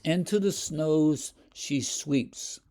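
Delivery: slow, measured poetry recital; a touch of recital; poem reading. Shown as a demonstration. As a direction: read it like a poem, slowly. Teacher reads the poem aloud.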